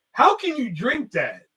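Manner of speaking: slow, high-pitched imitated disbelief; amazed mom. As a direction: mimic an appalled, astonished question. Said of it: "she was just amazed".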